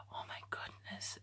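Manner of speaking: a worried breathy whisper under the breath; anxious whisper. Retold or imitated. Fretting to herself about a friend, whispered.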